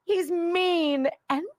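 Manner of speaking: slow, high, whiny is so mean; mock whine. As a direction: drag it out like a sulking child. Mocking critics' complaint.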